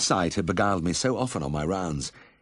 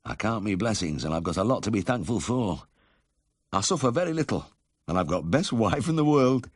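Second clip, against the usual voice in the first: lightly voiced husky Yorkshire miner; grateful northern invalid, pitch climbing; 5 semitones higher than usual. A narrator's reading. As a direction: count blessings with a touch of huskiness. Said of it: "the husky voice went on".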